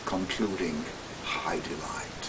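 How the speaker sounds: soft breathy whisper; hushed whisper. A narrator's reading. Narrating the climax, dropping to a whisper. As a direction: let it fade to a breath.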